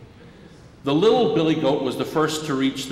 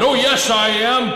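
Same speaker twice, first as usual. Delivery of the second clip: higher, louder mock troll retort; troll insisting; 8 semitones higher, 5 dB louder than usual. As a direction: snap back like a stubborn troll.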